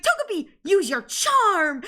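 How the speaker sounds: put-on sing-song order to the baby; sweet command. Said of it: "and then Misty's like".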